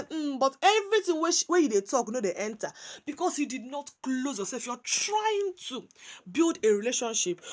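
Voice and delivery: usual speaking voice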